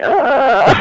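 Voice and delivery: high, loud demonstrated groan; exasperated whiny moan. Shown as a demonstration. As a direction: let out a long whining "uhhh". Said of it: "natural way to talk when we're feeling".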